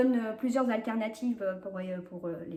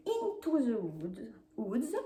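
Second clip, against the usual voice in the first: English title in a French speaker's English accent; showy English accent; 3 dB quieter, slower than usual. Shown as a demonstration. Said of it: "vous remarquerez mon magnifique accent". (accent anglais appliqué)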